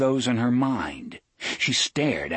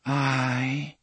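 dreaming voice; 6 semitones higher than usual. A narrator's reading. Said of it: "That same dreaming voice".

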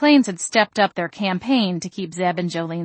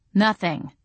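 ordinary delivery

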